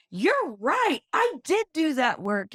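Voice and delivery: hammed-up proud agreement; imitating the dad. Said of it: "And he goes".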